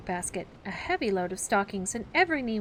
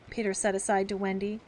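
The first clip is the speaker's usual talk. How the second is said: ordinary delivery, nothing marked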